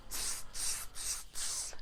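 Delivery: soft voiceless tss noises; monkey chatter. A narrator's reading. Monkeys answering the peddler back, soft hissy chatter. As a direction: imitate monkeys with little hissed clicks. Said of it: "and said".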